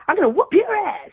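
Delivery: put-on high, rough threat from an old lady; tough granny. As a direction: threaten like a hard-bitten granny. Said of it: "They're like".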